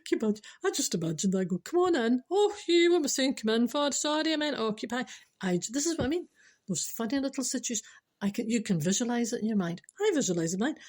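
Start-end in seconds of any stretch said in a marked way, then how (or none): 1.6-5.0 high sing-song call tipping into fluster. Acted. Host imagining answering a toilet knock, flustered. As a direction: call out high, then scold yourself and apologise. Acting out flustered mistake at door.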